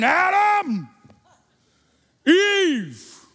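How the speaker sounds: booming, high-pitched furious shout; angry God. Acted. Preacher acting God hunting Adam and Eve, angry shout. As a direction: roar it out, stomping mad. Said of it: "Is it an angry voice?".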